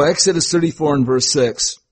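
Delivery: ordinary talk, nothing marked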